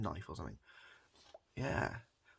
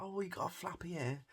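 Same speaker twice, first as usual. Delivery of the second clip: put-on higher, excited kid voice; imitating kid; 10 semitones higher than usual. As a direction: play a kid thrilled by a flappy ear. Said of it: "all the kids are like".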